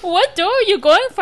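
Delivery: can barely get the words out for laughing; laughing through quote. Retold or imitated. Retelling her cousin's question while cracking up.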